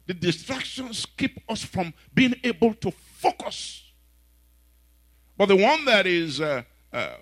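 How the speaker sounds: high, loud preaching, slow and emphatic; full-force preaching. Acted. Preacher rallying the church, shouting at full force. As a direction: preach it out to the rafters.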